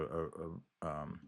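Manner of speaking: ordinary delivery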